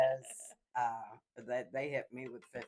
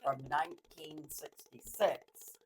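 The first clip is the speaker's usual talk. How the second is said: car salesman pitch; 2 semitones higher, 6 dB louder than usual. Pretend. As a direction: pitch it like a used car dealer. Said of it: "I'm gonna sound like a car salesman again".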